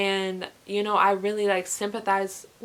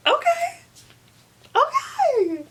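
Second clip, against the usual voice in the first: high squealing okays breaking into a laugh; giddy, much higher okays with a giggle; excited squeal; 4 dB louder, slower than usual. Real, not acted. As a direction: fangirl squeal about the character. Gushing over favourite book love interest.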